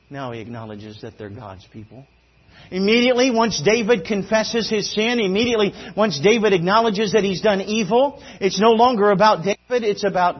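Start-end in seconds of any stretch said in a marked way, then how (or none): 2.7-10.4 loud, raised preacher's voice; punchy, high-energy sermon delivery; full-force preaching. Real, not acted. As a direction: drive it out to the whole congregation.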